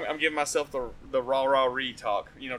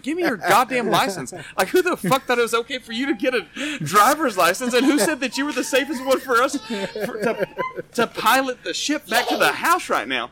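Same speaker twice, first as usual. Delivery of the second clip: higher, louder frantic rant; scared yelling; 8 semitones higher, 5 dB louder than usual. Retold or imitated. Passenger yelling at Kayla for her driving, frantic. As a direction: yell at the driver in half-laughing panic. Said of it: "I was like".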